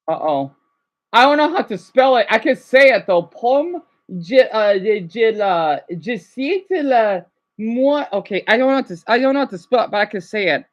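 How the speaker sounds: ordinary talk, nothing marked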